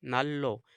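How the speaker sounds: नल्लो repeated softly with a doubled l; नल्लो, the held double l; Korean pronunciation drill. Shown as a demonstration. Teacher repeats नल्लो softly for students. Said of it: "तो इसको नल्लो".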